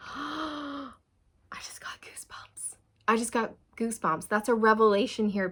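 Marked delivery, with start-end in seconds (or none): 0.1-2.8 breathless whispered gasp; awed whisper. Real, not acted. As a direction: whisper it, overwhelmed. Sudden goosebumps realization.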